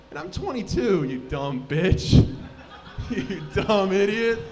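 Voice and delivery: hammed-up petty outrage at a child; indignant insult. Acted quarrel with a seven-year-old.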